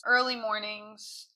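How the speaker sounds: ordinary talk, nothing marked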